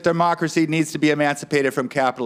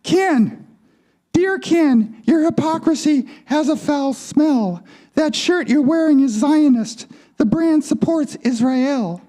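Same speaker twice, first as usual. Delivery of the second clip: high feminine twang; high-voiced puppet-show doll with a twang; Barbie's feminine twang; 10 semitones higher than usual. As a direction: pitch it up into a girly doll voice. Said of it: "In her feminine twang".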